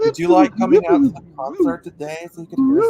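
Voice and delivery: put-on high, bubbly Valley Girl accent. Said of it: "my Valley Girl accent".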